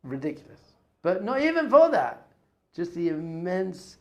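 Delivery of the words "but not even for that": emphatic. Real, not acted. Emphatic turn.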